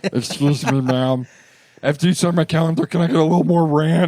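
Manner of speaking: lightly put-on hapless fan voice; mimicked ugly customer. Ugly guy asks waitress for ranch, lightly mimicking. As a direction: lightly imitate a sheepish restaurant regular.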